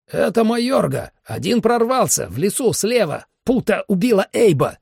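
high-pitched, excited battle report; urgent radio report. Acted. Fighter radios in from the fight, high and urgent.

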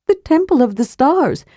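sly kender explaining the way to the rune; Tass the kender. Acted.

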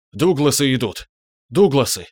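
frantic cry, voice cracking; voice-breaking shout. A narrator's reading. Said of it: "срывающимся голосом закричал он".

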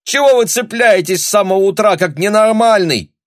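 high, loud angry shout; losing patience. A narrator's reading. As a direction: yell back at the nagging, fed up. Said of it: "закричала в свою очередь и Таня".

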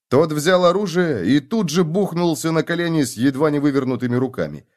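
usual speaking voice

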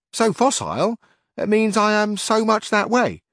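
earnest bumbling squire; Sancho Panza. A narrator's reading. Sancho tells his master his wife agreed, loud and eager.

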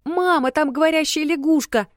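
put-on high excited little girl shouting. A narrator's reading. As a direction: blurt it out, thrilled and loud. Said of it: "взволнованно кричала малышка".

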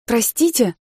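loud, firm and high. A narrator's reading. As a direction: cut them off firmly. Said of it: "твёрдым голосом произнесла я".

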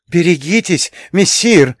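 high-pitched squealed warning; frightened squeal. A narrator's reading. Frightened Matroskin warning his master of flames, high squeal. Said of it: "заверещал Матроскин".

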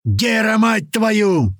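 high, drawn-out angry roar. A narrator's reading. Cursing at Gera amid the attack, roaring. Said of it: "взревел ещё сильнее Туман".

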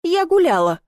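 high, indignant outburst; shouted protest. A narrator's reading. Heroine defends herself to the hosts, high indignant cry. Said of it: "крикнула".

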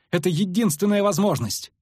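high, agitated insistence; urgent insistence. A narrator's reading. Lyutik insists to the Witcher on escape, urgently.

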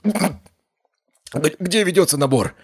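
high, startled yell; shouting. Acted. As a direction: blurt it out at full voice. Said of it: "заорал он так громко".

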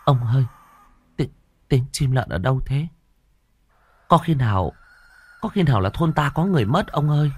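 high, rushed frightened pleading; wife frightened by a bird's cry at night. A narrator's reading. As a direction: clutch her husband's arm and ask in fear. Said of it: "Quan bà kinh hãi".

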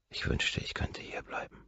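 breathy unvoiced whisper. Whispering into the phone to her lover, wistful. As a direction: whisper it, wishing to stay.

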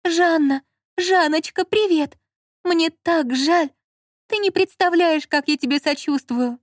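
high-pitched, gushing sympathy; full of compassion. A narrator's reading. Lola phoning Zhanna with put-on condolences, compassionate. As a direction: overflow with pity for her. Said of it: "проговорила полным сострадания голосом".

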